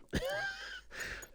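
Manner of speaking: breathy giggle. Real, not acted. Joking about paying double.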